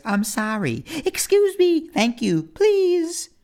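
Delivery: mock prim politeness; goody-goody voice. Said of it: "He always says".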